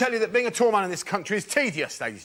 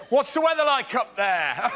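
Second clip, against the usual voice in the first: mocking local; 6 semitones higher than usual. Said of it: "Another joke might be".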